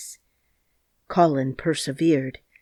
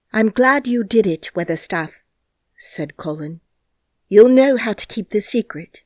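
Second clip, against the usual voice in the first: put-on higher, louder confident boy; boy approving the old gardener, louder and higher; pleased young master; 5 semitones higher, 8 dB louder than usual. A narrator's reading. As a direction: sound proud and in charge. Colin pleased and commanding.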